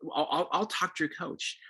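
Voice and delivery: lightly voiced eager mom; a hint of jumping in to fix; problem-solving mom. Mom promising to call the coach, lightly voiced.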